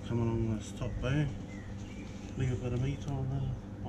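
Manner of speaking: normal talking voice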